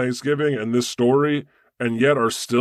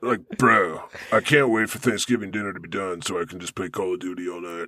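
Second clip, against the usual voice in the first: bro voice; 6 semitones lower, 4 dB quieter than usual. Pretend. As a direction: play a laid-back gamer bro, quick and low. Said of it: "This is my bro voice".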